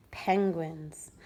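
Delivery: hushed whispered title. Acted. Reader whispering the penguins heading to a child.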